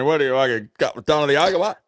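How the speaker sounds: put-on confused old farmer squinting at the pitch. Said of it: "an old cocky".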